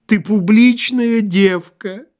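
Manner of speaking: slow, high wailed insult; drawling whine. A narrator's reading. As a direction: drag each word out in a high moan. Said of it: "тягуче заныл он".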